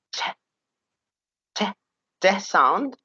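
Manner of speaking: crisp isolated t sound, said twice. Shown as a demonstration. Teacher drilling the t sound for students. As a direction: say the t sound of E D clearly. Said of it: "here the E D could be".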